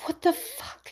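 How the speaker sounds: stunned breathless whisper; whispered what the fuck; whispered shock. Retold or imitated. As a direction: hiss it in disbelief. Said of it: "she was like".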